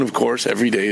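ordinary talk, nothing marked

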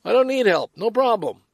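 put-on upbeat "I'm fine" to hide the hurt; brave front. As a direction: brush it all off brightly. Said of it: "Not just stiff upper lip".

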